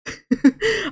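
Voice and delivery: squeaky laugh; short high giggle. Real, not acted. Laughing at chat.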